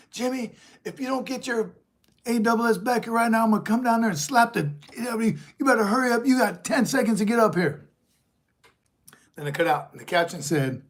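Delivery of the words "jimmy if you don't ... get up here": put-on much higher, louder furious yell at the boy; angry sister yelling. Older sister yelling the boy back up, furious mock shout. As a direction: threaten him from up the bank, full voice. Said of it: "she's like".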